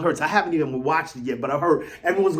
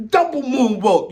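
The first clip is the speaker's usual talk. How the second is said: mocking the players; 5 semitones higher than usual. Fan mocking rival players, pitched high and mocking. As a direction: ham up the players' dismay at getting beaten. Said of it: "they like".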